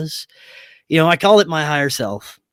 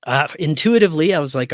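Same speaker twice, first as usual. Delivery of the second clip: ordinary delivery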